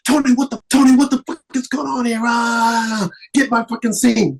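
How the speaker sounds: high, shouted imitation of an angry director; retold screaming director. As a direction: yell it like a furious director storming in. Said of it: "he would come in screaming and yelling".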